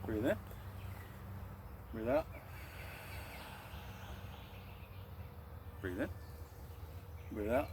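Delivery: soft, even breath counting; steady the class breath by breath; guided breathing cues. Acted. Instructor calmly counting breaths for class.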